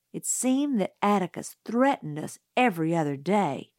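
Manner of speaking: ordinary delivery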